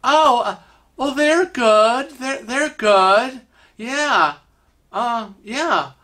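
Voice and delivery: high, hesitant, drawn-out answer; amazed, slightly shocked. Acted. Guy stunned that Kevin remembers his family, amazed. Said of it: "looking amazed and a little shocked".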